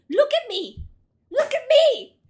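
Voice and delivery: rising shout at the screen; yelling. Real, not acted. Streamer yelling at game character.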